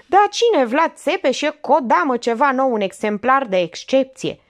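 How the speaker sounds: very high, loud sneer; mock the question; sarcastic outburst. Olga mocking her aunt's question, loud.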